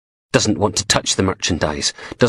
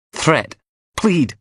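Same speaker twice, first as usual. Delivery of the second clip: plain reading voice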